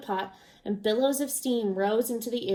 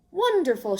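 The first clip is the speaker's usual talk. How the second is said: higher, louder cheer; shouted joy; 7 semitones higher, 5 dB louder than usual. A narrator's reading. Travelers cheering the child's carrot, loud and high. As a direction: cheer them on. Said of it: "shouted the travelers".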